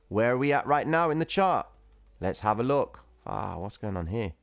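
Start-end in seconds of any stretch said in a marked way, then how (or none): none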